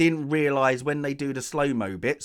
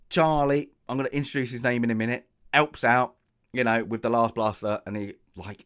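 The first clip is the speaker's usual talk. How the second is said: ordinary talk, nothing marked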